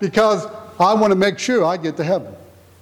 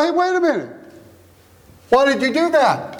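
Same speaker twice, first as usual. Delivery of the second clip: high, sudden "hey" of a father catching his son; dad stopping him; 6 semitones higher than usual. Retold or imitated. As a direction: imitate dad halting the kid mid-step. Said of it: "And he goes".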